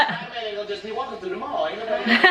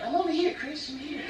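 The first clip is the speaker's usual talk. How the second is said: higher, softer breathy-free falsetto; soft high Michael voice; 8 semitones higher, 4 dB quieter than usual. Retold or imitated. Friend imitating Michael hiding in disguise, high and soft. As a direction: imitate him small and hushed. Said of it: "Michael would be covered up like".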